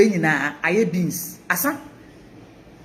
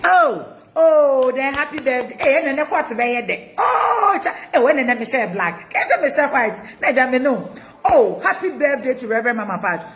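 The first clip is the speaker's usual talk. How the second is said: high, gushing birthday excitement; excited exclaiming; 5 semitones higher than usual. Real, not acted. Gushing birthday greeting to Mama Pat.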